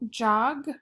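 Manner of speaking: ordinary talk, nothing marked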